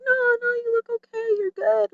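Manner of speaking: high-pitched mock reassurance; reassuring family. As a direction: imitate friends brushing off a worry in a high voice. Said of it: "my family or friends would be like".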